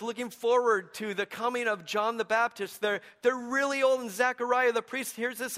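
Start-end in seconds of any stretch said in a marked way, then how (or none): none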